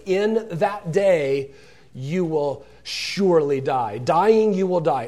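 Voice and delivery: slow, weighted "in that day", building to a loud "Dying"; forceful stress on "Dying, you will die"; emphatic pronouncement. Shown as a demonstration. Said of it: "Emphasis on you're dead".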